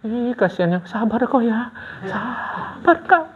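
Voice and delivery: nada tinggi lembut, iba yang dibuat-buat; pura-pura kasihan di mulut, suara dinaikkan dan dipelankan; simpati pura-pura. Acted. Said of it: "Tapi mulut". (belas kasihan palsu bernada tinggi)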